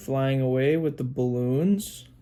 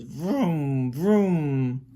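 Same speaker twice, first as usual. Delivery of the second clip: long revving vrooms, second one louder; car engine noise; 4 dB louder than usual. Acted. Parent showing what the car says, car engine noise. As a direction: rev up like a car. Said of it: "The car says".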